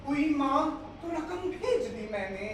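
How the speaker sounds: loud, high-pitched verse declamation; mushaira poetry recitation. Acted. Poet telling of a sick mother and money sent, declaimed verse. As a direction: call the couplet out to the hall.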